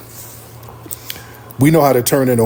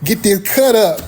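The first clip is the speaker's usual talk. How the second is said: mimicking girlfriend; 7 semitones higher than usual. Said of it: "And that means".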